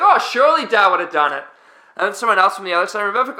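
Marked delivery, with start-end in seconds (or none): 0.0-1.4 loud, raised mock-Aussie voice; Australian accent. Another teammate piping in across the room, Australian accent. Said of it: "someone would pipe in across the room".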